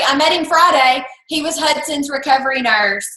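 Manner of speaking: ordinary talk, nothing marked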